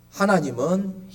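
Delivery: normal talking voice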